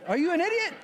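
high-pitched mock outburst; sudden scolding. Paul smacking the church after luring them, scolding. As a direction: snap into a high, incredulous rebuke. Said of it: "he's getting ready to smack them".

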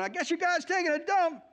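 high mocking jab through a laugh; gleeful laughing trash talk; laughing taunt. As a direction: crack up while needling them. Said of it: "he's like trash talking them".

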